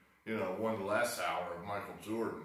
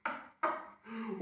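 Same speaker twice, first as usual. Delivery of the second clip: high laugh; 12 semitones higher than usual. Real, not acted. Laughs after mock screaming.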